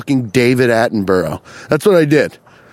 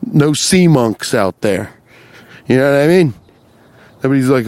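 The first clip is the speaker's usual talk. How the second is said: ordinary delivery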